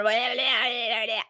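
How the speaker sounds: mock possessed tongue-flapping babble; wagging-tongue noise. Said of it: "her tongue going".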